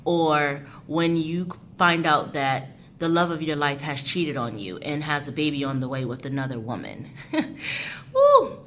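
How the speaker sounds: ordinary delivery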